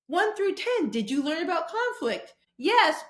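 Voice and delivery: high-pitched mock survey question. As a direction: ask it like a cheesy survey form.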